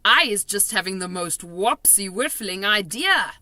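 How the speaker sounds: bubbling over with a plan; excited giant. A narrator's reading. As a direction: BFG thrilled with his idea. BFG excited.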